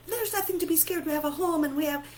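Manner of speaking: high-pitched, hurried mock reassurance; mock dismissive parent. Parent trying to fix her girls' fear, high mock fussing.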